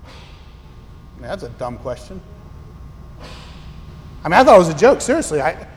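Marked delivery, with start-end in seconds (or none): 0.1-2.2 soft, scornful aside under the breath; half to yourself, that's dumb; muttered aside. Real, not acted. Aside mocking parent's question.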